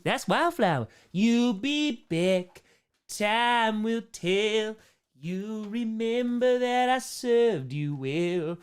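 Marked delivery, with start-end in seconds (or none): 1.1-8.6 slow, high, put-on character singing; Tome voice. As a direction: sing it slowly as the Tome character. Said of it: "in a Tome voice".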